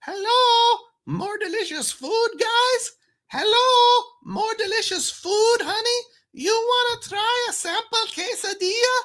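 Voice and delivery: put-on very high, sing-song sales call with an accent, held all the way through; high-pitched accented street vendor calling out to customers; imitating the food truck lady's accent. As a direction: hawk food to passersby in a high, lilting accented voice. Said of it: "some kind of accent, that sounded like this".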